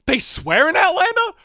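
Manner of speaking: high-pitched disbelieving question; acted-out astonishment; incredulous. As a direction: squeak it out in loud disbelief. Retelling own disbelief to mum.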